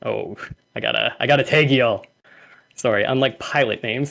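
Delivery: ordinary talk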